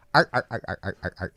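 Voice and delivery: clipped mock "arr, arr, arr" brush-off; wife's dismissive noise. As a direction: imitate a busy wife acknowledging a pun. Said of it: "it's a noise she makes if she's busy".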